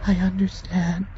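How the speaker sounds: high, grateful tender farewell; dying Junie. Acted. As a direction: thank him with devotion.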